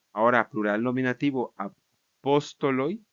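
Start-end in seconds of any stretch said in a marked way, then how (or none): 1.6-3.0 slow "apóstoloi", stress on PÓS; antepenultimate stress. Shown as a demonstration. Teacher reciting plural nominative, slow stress on PÓS in "apóstoloi". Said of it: "Regresa a la posición".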